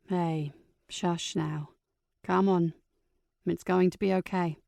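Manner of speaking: put-on calm her like a frightened child; soothing. Aunt soothes crying niece.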